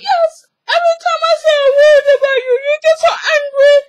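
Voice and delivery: fast, loud, squeaky mock wailing; mock tearful mother. Mother complaining about daughter's temper, mock frantic wail. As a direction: pour it out in a hammed-up high whine.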